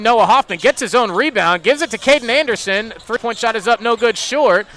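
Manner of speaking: energetic live game call, building on shots; play-by-play. Acted. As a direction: call each move as it happens.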